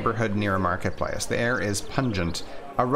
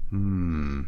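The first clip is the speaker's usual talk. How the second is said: usual speaking voice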